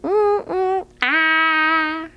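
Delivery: silly frog sound with tongue out; mock tongue-out ah; tongue-out frog noise. Shown as a demonstration. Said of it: "stick out your tongue".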